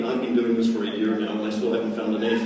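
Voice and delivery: low, deflated complaint; mock dejection. Recruit after a fruitless year, mock dejected. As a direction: let the voice sink low, giving up. Same recruit, now giving up.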